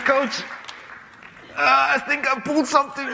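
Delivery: fake injury excuse. Young player faking an injury to dodge marking Ronaldo, high and laughing. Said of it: "I said".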